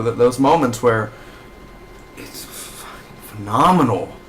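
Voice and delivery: usual speaking voice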